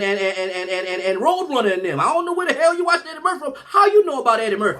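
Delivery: high-pitched, escalating incredulous rant; hammed-up mother's indignant interrogation; outraged mother. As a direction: scold as a scandalized mom, stammering midway. Outraged mother interrogates her son.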